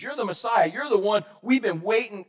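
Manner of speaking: ordinary talk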